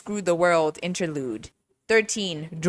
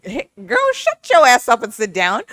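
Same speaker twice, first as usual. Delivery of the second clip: mock telling off; 4 semitones higher, 5 dB louder than usual. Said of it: "I'm just like".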